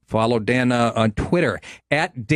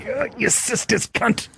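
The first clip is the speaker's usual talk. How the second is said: imitating Uncle Junior; 7 semitones higher, slower than usual. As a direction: groan it out like a hurt old mobster. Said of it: "He goes".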